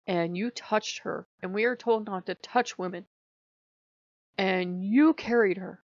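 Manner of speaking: indignant scolding. Acted. Monk Nomi lists rules Yesmi broke, indignant scolding.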